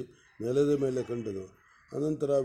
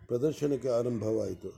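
usual speaking voice

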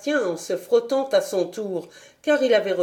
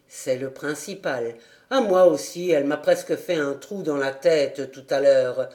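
lower, gentle consoling servant voice; compassionate butler; 5 semitones lower than usual. A narrator's reading. Butler consoling the girl on the stairs, gentle and low. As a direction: comfort the upset child kindly. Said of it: "d'un ton compatissant".

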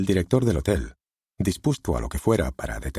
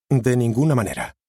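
firm refusal, higher and louder; refusing; 6 semitones higher, 4 dB louder than usual. A narrator's reading. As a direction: brush the porter off hard. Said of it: "se negó Steven". (negándose en voz alta y firme)

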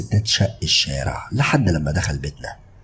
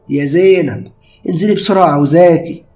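mother calling upstairs, much higher and louder; 11 semitones higher, 8 dB louder than usual. Acted. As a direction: call her daughter down from below. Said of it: "وهي بتنده عليا". (نداء عالي من الأم)

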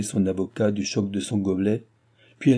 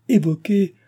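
much higher and louder disbelief; astonished cry; 10 semitones higher, 6 dB louder than usual. A narrator's reading. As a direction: still reeling at the news. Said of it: "cria la comtesse en bondissant". (stupéfaction criée)

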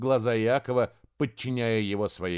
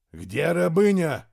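through gritted teeth; 5 semitones higher, 5 dB louder, slower than usual. Acted. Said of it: "Слова смешались у Тремира со скрипом зубов".

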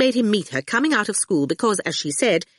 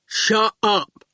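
goon voice; 3 semitones higher than usual. A narrator's reading. Goon tells Awful.

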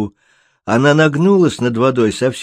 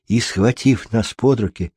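plain reading voice

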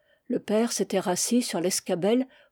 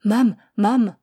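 calling softly; 6 dB louder than usual. Acted. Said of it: "J'appelai doucement ma mère".

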